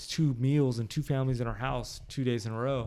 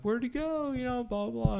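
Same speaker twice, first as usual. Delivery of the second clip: put-on puzzled kids; 11 semitones higher than usual. Kids voiced in retold story.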